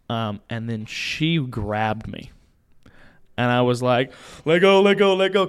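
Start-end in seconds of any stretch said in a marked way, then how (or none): 4.4-5.5 higher, louder pleading let go; mock panicked plea, higher and louder; retold cry to let go. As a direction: call out to be released. Customer grabbed her wrist.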